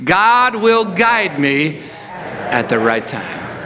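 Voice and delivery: very slow, deliberate, every word weighed; slow declaration. Shown as a demonstration. Pastor leading the church to declare it, very slow. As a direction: let each word sink in, slowly. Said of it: "just hear yourself say this".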